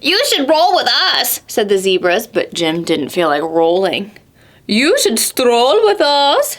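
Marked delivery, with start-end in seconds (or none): 0.0-1.4 lightly raised zebra voice; a hint of a friendly zebra. Zebras inviting Jim to roll, lightly voiced. Animals coax Jim in story. 4.7-6.6 slow, lightly raised peacock. Acted. Peacocks inviting Jim to stroll, slow. Animals coax Jim in story.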